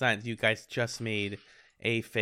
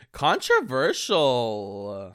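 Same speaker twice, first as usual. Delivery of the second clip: sarcastic; 5 dB louder, much slower than usual. Acted. Sarcastic one-word verdict on dog.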